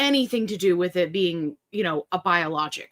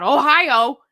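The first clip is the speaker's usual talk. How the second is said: loud, drawn-out imitation of a yell; teasing shouted state name; mock yelling; 5 semitones higher, 7 dB louder than usual. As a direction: holler it in playful imitation. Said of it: "Lynn was yelling at me".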